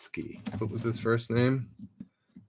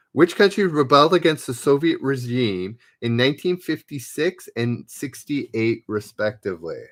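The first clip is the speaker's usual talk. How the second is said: louder, announcing quiz question; quiz host read; 3 semitones higher, 6 dB louder than usual. Acted. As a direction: read the question out to the players like a quizmaster. Reads quiz question aloud.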